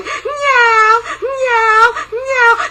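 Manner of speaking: high, loud yowling meows; shrill, drawn-out cat cries; scary cat meows. Acted. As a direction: yowl like a monster cat. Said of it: "kept coming towards them going".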